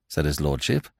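ordinary delivery, nothing marked

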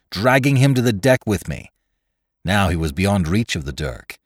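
ordinary delivery, nothing marked

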